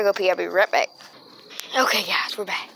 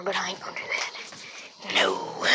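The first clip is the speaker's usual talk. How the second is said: whispering; 3 semitones lower, 5 dB quieter, slower than usual. Real, not acted. Quiet aside at night.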